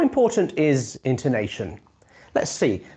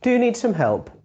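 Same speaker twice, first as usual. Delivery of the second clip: higher sarcastic question, falling at the end; mock the person's need for help; 8 semitones higher than usual. Shown as a demonstration. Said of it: "It can be sarcastic".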